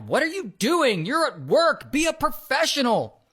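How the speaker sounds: indignant reproach, lightly acted; retold scolding. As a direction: imitate a boss reprimanding staff. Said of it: "he starts scolding Ellis".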